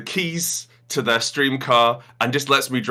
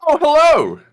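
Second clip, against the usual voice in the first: mock surprised greeting; 5 dB louder than usual.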